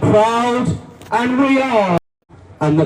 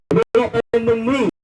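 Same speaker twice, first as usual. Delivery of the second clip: fast, without weight; quick throwaway repeat; 3 semitones higher, faster than usual. Acted. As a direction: rattle off "We will not bend the knee" in passing. Rally speech, quick repeat.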